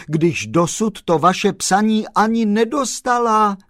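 loud, high, gleeful torrent; excited postman crying out with joy; joyful shouting. A narrator's reading. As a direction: reel it off in delighted exasperation. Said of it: "vykřikl pan Kolbaba radostně".

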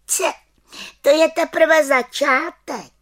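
high, loud, slow proud boast; little witch boasting. Acted. Little witch boasting to the children, high and proud. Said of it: "prohlásila malá čarodějnice".

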